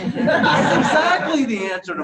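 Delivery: high, loud eureka outburst; excited realization. Retold or imitated. Reader finally reaching the math in the book, high excited realization. Acts out sudden understanding while reading.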